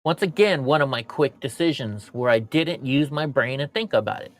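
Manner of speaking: ordinary talk, nothing marked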